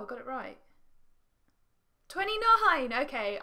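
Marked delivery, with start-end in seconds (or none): 2.1-2.9 high, loud triumphant cry; excited shout. Real, not acted. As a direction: shout out a winning score. Delighted by a better score.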